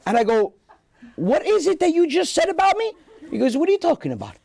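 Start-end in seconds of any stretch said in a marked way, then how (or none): 1.2-2.9 confronting dad. Retold or imitated. Confronting his dad on phone. 3.6-4.4 put-on much higher Italian-accented feigned innocence; Italian father playing dumb; father denying everything, Italian accent; innocent Italian dad. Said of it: "with that accent".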